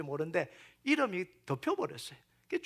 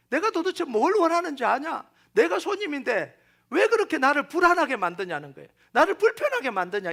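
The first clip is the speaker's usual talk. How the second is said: higher, louder retold reproach; imitated rebuke; 6 semitones higher, 5 dB louder than usual. Jesus as guest reproaching his fussing host, in imitated rebuke. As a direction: complain as the acted, exasperated guest.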